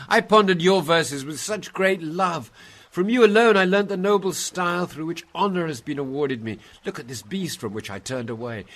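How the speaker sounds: loud, high, fervent plea to a revered teacher; pleading devotion. Acted. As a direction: praise him, then beg for protection. Dante pleads with his master.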